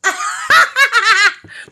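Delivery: high, loud burst of laughter; big laugh. Real, not acted. Mother laughing hard at her son's reply. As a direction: crack up out loud.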